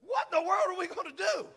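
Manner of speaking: high, alarmed outcry; panicked servant. Acted. Servant to Elisha on seeing the army, high panicked cry. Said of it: "In the Luke Saint Clair version".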